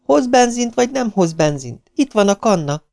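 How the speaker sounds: high-pitched insistence; foot-stamping impatience. A narrator's reading. Stranded girl ordering the man to fetch petrol, impatiently. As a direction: demand it impatiently, pitch well up. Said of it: "A lány toppantott".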